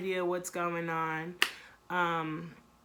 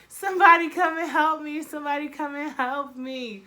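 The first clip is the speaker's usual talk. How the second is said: loud, higher yell for help; imitating a panicked yell; mock call for help; 7 semitones higher, 8 dB louder than usual. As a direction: shout out for someone to come. Boyfriend calling for help.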